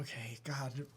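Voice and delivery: whispered exasperation; whispering. Real, not acted. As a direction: breathe it out under your breath. Muttered exasperation.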